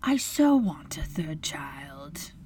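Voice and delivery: slow, soft whispered reassurance; tender mother. A narrator's reading. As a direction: comfort a hurt child, barely voiced. Mother comforting her hurt child.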